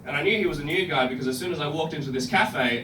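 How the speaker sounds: ordinary delivery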